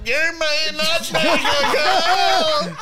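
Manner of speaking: very slow, high, strained mock menace; exaggerated impression of a stern order-giver; Undertaker. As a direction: drag each word out in a hammed-up voice. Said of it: "he turns into the fucking Undertaker".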